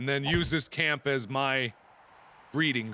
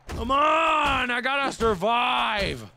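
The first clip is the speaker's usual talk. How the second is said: frustrated shout; 7 semitones higher, 4 dB louder, much slower than usual. Real, not acted. Frustrated at the game.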